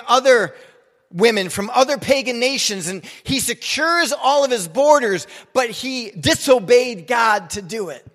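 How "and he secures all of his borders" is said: usual speaking voice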